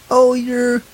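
mockingly talking down; infantilizing. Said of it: "it just is so infantilizing".

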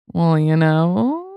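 very drawn-out, rising, teasing reply; coy hint. Retold or imitated. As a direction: imitate someone hinting at a secret. Agent coyly hinting at a win.

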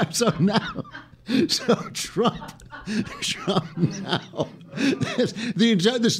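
high-pitched, helpless real laughter; apologizing through fits of giggles; cracking up. Real, not acted. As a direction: try to carry on while corpsing. Cannot stop laughing, apologizes.

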